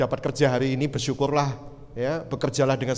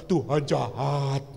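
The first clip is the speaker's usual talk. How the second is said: slow, drawn-out mock whine; mock complaint; much slower than usual. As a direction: stretch it like a sulky accusation. Mimicking complaint when blessings are taken.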